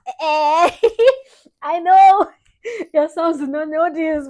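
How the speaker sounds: loud laughing exclamation; laughing excitement. Real, not acted. Daughter thanking dad for money, laughing loud. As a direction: burst out laughing with joy.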